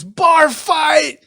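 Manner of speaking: high, loud shout across the bar; screamed alarm. Acted. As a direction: scream it to set off a brawl. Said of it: "and someone screams".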